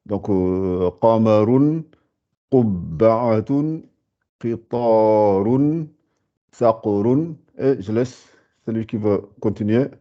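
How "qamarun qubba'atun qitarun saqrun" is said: qamarun, qubba'atun, qitarun, saqrun read slowly; slow model reading. Shown as a demonstration. Teacher rereads the four words slowly for the class. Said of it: "je vous ai fait les quatre".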